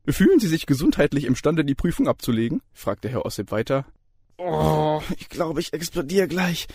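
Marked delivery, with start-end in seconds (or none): 0.1-2.6 much higher, louder cheery voice; jovial lecturer. A narrator's reading. Lecturer opens the exam, cheery and high. Ossip asks the student. 4.4-6.7 higher, pained inner voice; strained inner thought. A narrator's reading. Student thinks during the exam, pained. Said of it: "dachte Daniel".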